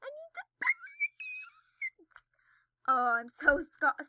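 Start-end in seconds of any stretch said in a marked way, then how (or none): 0.0-2.3 very high, drawn-out squeal; ear-splitting squeal. Real, not acted. Player losing balance in the game, ear-splitting squeal. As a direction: stretch it into a piercing cry. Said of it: "sorry for hurting your ears".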